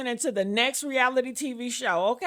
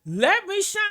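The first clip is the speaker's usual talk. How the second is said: mocking quote; 6 semitones higher than usual. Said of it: "and your response is".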